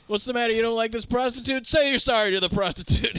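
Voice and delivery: high, mocking cop imitation; taunting cop. Cops teasing the man in the car, high taunting imitation. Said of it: "and they're like".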